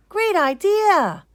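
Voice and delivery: high, loud, drawn-out approval; excited cheer. Acted. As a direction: cheer the plan together. Everyone cheers an idea.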